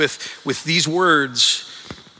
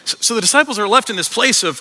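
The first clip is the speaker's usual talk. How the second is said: ordinary talk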